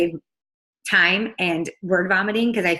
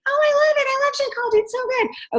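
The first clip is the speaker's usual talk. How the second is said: far higher, bubbly customer voice; voicing the gushing customer. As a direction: gush like a delighted girl in a high squeal. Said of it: "this is literally what I say".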